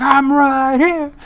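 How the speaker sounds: high, loud, drawn-out holler; imitating a tiny guy's long yell; imitated yelling. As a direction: holler across the jail, stretching the words. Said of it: "just yelling the same thing".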